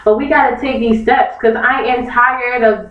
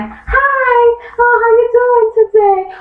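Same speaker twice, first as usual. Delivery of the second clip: much higher, sugary mock greeting; 13 semitones higher, slower than usual. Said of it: "they be like".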